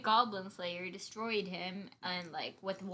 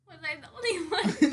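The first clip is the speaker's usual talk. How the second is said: laughing; 7 semitones higher, 4 dB louder than usual. Real, not acted. Both laughing.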